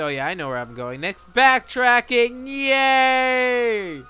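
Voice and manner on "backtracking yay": high, drawn-out sarcastic hooray; mock cheer. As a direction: cheer slowly in exaggerated fake delight. Fake cheer, then "not a yay.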